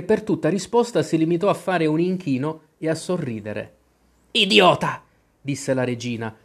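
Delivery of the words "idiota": louder, higher scornful snap; impatient insult. Acted. Queen scolds the bowing knave, louder scornful. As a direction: spit out the insult. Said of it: "scuotendo impaziente la testa".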